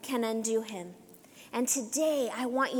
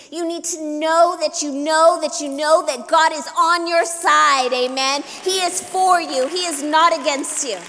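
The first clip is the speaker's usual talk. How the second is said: loud, insistent preacher's assurance; rousing preaching; 3 semitones higher, 7 dB louder than usual. Real, not acted. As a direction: drive it home to the whole congregation.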